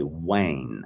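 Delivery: wane drawn out slowly as one syllable; dialect word for where. Shown as a demonstration. Teacher showing how dialect says "where", dialect pronunciation. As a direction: say wane slowly, rhyming with rain. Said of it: "to say aina enta, you say".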